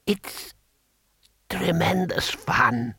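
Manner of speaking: high, slow, whispery delight; ancient emperor, gleeful. A narrator's reading. Emperor enjoying cruelty, high whispery glee. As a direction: wheeze it out with a grin. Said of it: "grinning happily".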